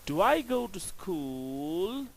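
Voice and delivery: "Do I go to school?" slowly, dipping then rising on "school"; rising question tone. Shown as a demonstration. Teacher contrasts a question with a statement, rising slowly. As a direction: drag it out and let the end climb. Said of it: "the tone is rising".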